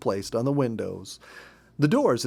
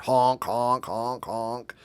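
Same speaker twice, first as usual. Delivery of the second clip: porcupine honks; much slower than usual. Retold or imitated. Porcupines interrupt from tree.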